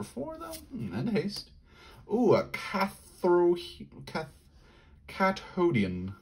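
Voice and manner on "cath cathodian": normal talking voice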